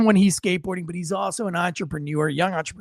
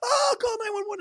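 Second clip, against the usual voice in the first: high screams that fade into a frantic plea; scream, then beg someone to call for help; imitated panicked screams; 3 dB louder than usual. Imitating the women on the bench fleeing the kid, screaming high.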